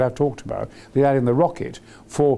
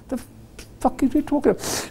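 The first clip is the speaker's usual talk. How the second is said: high, incredulous outburst; a mock-baffled high cry; baffled squawk; 11 semitones higher, 3 dB quieter, faster than usual. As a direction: react like a stunned villain. Ming's baffled reaction voiced.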